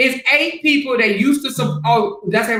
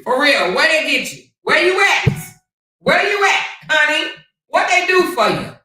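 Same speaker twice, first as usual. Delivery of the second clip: sing-song mocking shout; mock taunting yell; 6 semitones higher than usual. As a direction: jeer at him from afar, pitched way up. Mock taunting at absent man.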